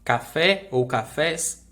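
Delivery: "Café ou cafés" slow, the final syllables stressed; last syllable stressed. Shown as a demonstration. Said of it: "sílaba um mais forte". ("Café ou cafés" com a última sílaba forte)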